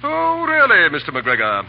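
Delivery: mock surprise. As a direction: play the shocked gentleman to mock him. Forsyth teases McGregor mockingly.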